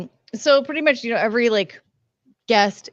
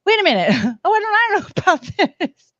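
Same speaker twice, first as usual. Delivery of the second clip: laughing quote; 9 semitones higher, 3 dB louder, much faster than usual. Retold or imitated. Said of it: "I'm like".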